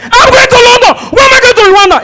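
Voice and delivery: high, loud frantic yelling; panicked shout. Retold or imitated. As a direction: shout it in outrage at the detour. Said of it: "Oga, you are shouting".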